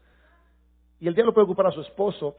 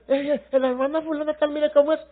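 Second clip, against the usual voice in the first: imitating gossiping people; 8 semitones higher, 4 dB louder than usual. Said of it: "Sí, porque la gente".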